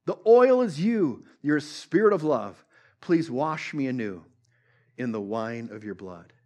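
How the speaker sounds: slow, measured recital of the lyric; gently recited song lyric, spoken not sung; reciting a chorus. Acted. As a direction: read the chorus line by line, unhurried. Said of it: "It goes like this".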